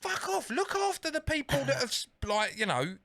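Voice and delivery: high-pitched indignant customer tirade; hammed-up fed-up caller, voice pushed up; mock outraged rant. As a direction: rant in comic exasperation. Retold angry rant at phone company.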